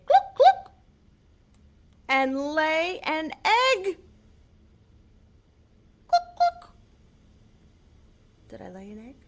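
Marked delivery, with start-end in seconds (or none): 0.1-0.7 very high, loud hen cluck. Acted. Showing kids how a hen clucks, high and loud. 6.1-6.7 very high, loud hen cluck. Acted. Showing kids how a hen clucks, high and loud.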